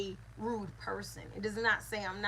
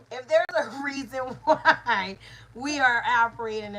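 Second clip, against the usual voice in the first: loud restart through a laugh; 8 dB louder than usual. Real, not acted. Teacher restarting her point after a laugh, laughing loudly. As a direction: push the words out over your laughter.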